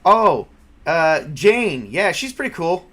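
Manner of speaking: usual speaking voice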